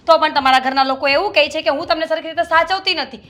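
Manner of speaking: wife venting to her husband's family; building anger. Acted. Wife's angry complaint builds.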